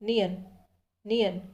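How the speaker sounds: नियन said as niyun, short and falling; name it crisply twice; letter name said clearly. Shown as a demonstration. Teacher naming the second consonant, clear and falling. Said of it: "दूसरा व्यंजन है".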